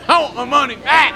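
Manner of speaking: high, loud mock yell; imitated disgruntled customer. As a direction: holler it like an outraged audience member. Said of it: "You hear that dude?".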